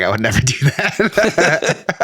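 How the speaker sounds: slow words broken by laughter; laughing. Real, not acted. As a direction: laugh your way through it. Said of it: "I'm totally kidding".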